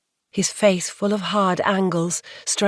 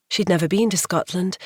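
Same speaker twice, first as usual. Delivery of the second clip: ordinary delivery, nothing marked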